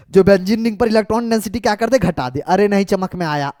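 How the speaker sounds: ordinary talk, nothing marked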